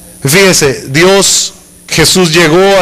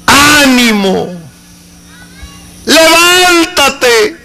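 encouraging call; 7 semitones higher, much slower than usual. Acted. Jesus calling the beggar.

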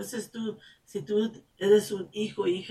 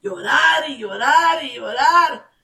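high, loud voice breaking into tears; tearful; 7 semitones higher, 10 dB louder than usual. Real, not acted. Preacher overcome while describing tears of joy, crying. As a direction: cry as you say it.

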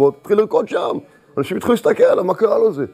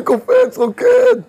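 very high, louder bursts; sudden ecstatic joy; 4 dB louder, much slower than usual. Acted. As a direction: leap up high on each word. The joy returns.

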